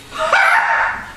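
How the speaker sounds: high breathy scoffing bark of a laugh; scornful shout. Acted. Drunk owner scoffing at the offer, breathy bark. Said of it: "shouted Mister Nye".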